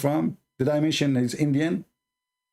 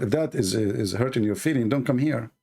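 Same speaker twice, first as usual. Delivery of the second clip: ordinary delivery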